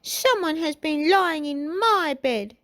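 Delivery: put-on high, slow baby-bear voice; crying out as Baby Bear. A narrator's reading. Baby Bear looking at his bed, high-pitched cry. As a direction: pipe it out like a little bear. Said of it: "he cried".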